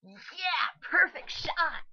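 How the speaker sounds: put-on high, loud, slow gloating boy; cocky boy voice. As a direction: crow over the hit like a brat. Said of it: "Oh wow, I actually like that voice".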